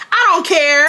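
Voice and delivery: loud, high, exasperated insistence; emphatic retort. Retold or imitated. Speaker insisting to her friend she doesn't care, exasperated and loud. Repeats retort more forcefully.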